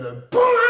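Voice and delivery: loud, very high boing; spring up sharply on the sound effect; imitated "boing". Storyteller imitating a light bulb popping, loud high boing.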